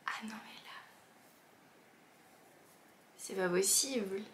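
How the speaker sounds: awed breathy whisper. Real, not acted. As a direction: hush it in amazement. Touching the toothbrush in awe.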